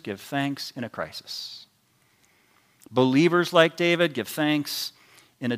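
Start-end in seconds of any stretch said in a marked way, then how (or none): 0.0-1.6 quiet. Shown as a demonstration. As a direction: give thanks said low and soft.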